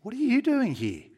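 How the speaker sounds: high, loud startled question; shocked angel. Acted. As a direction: ask it as if taken aback by who turned up. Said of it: "looks at him shocked and says".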